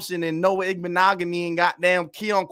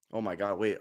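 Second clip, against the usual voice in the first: ordinary delivery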